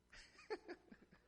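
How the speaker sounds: high breathy giggle; airy laugh. Real, not acted. Pastor tickled by an old saying, high breathy giggle.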